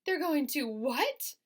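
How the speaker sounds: high, loud, slow shocked cry; scream. A narrator's reading. Wilbur hearing he'll be killed, high loud cry. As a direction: shriek it out in disbelief. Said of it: "screamed Wilbur".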